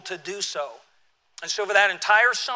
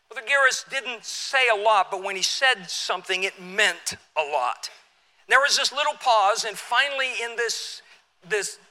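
ordinary talk

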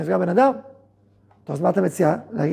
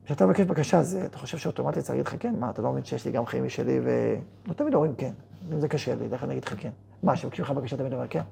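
softer, lower reasoning; calm explaining; 5 semitones lower, 7 dB quieter than usual. Shown as a demonstration. Speaking to an angry friend, soft calm reasoning. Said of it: "תגיד לו".

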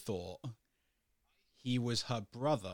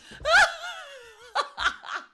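very high, loud burst of laughter; shrieking laugh; 10 dB louder than usual. Real, not acted. Luna bursting out laughing at the podcast story.

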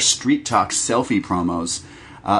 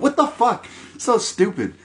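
exasperated; 11 semitones higher than usual. Real, not acted. Exasperated outburst.

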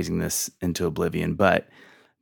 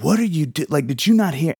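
retold angry outburst; 8 semitones higher than usual. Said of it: "I yelled a word".

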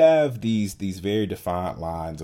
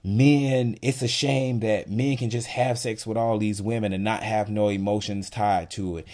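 ordinary talk, nothing marked

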